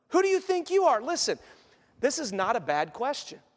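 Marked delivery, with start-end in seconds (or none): 0.1-1.0 acted affront, pitched high; indignant challenge. Religious leaders confronting Jesus over his authority, indignant challenge. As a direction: throw it out as a challenge. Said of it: "It's a question that if we truly ask it of God".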